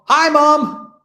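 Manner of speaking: high, loud shout to mom; calling out. Pretend. Streamer showing viewers how to greet mom, calling out. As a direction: holler a happy greeting into the stream.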